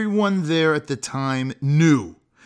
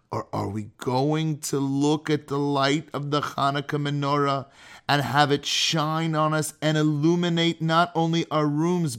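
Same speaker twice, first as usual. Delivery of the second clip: normal talking voice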